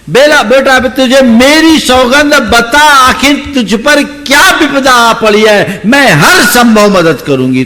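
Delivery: put-on high, loud tearful old woman begging; tearful pleading. A narrator's reading. Old woman weeping and begging the boy to tell her his trouble. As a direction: beg him through her own tears. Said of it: "वो भी उसके साथ रोने लगी".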